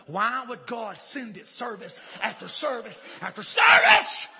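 slow repetition rising into a shout; building exasperation. Real, not acted.

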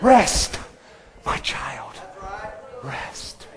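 soft whisper; Jesus whispering rest to a child; whispered comfort. Acted. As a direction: breathe it like a lullaby.

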